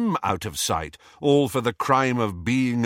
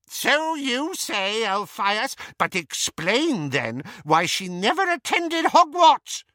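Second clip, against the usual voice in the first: put-on scoffing old aunt; 13 semitones higher, 3 dB louder than usual. A narrator's reading. Aunt dismissing Elphias's defence, high smug scoff. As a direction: brush him off like a smug old witch.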